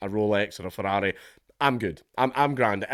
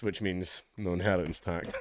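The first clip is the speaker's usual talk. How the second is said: ordinary talk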